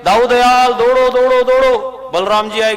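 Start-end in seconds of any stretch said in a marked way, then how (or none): 0.0-1.4 high, loud panicked call to Balram; calling for help. Acted. Cowherd boys call Dau for help, panicked high shout. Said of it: "आवाज लग गई". 1.4-2.1 a last high call दौड़ो, held high but less loud; calling for help. Acted. Cowherd boys keep calling Dau, fading high call. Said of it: "आवाज लग गई".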